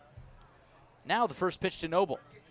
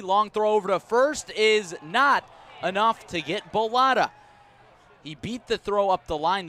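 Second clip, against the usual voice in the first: rising, much louder play-by-play burst; excited call; 6 semitones higher, 10 dB louder than usual. Real, not acted. Announcer calling a close play at first for listeners, excited and much louder.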